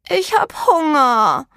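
put-on high, drawn-out drunken whine; whining. A narrator's reading. Drunk friend whining to her best friend, high drawn-out wail. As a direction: whine it high and slow, sliding down. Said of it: "jammerte Scarlett".

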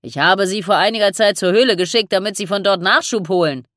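fast, projected captain's voice; calling up. Acted. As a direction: keep calling upward, high and hurried. Captain still calling up to rigging.

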